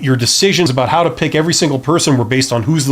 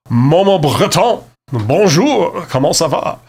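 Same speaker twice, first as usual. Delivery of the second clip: put-on French accent, pitched up, slower; 3 semitones higher, slower than usual. Reading a French viewer's chat greeting, in a hammed-up French accent. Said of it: "You can say it like this".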